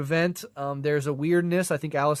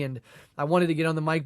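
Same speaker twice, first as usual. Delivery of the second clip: ordinary talk, nothing marked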